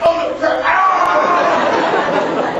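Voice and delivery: high loud panicked cry into a scream; frantic shout ending in a shriek; panicked yell. Retold or imitated. Acted scene then scream.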